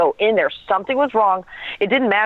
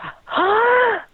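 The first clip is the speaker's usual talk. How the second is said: sharp gasp. Retold or imitated. Retelling forcing a first breath.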